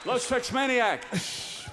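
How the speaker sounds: loud, slow, stretched announcement; band introduction shout. Real, not acted. Host announcing the band to the crowd, drawn-out shout. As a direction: boom out the band name.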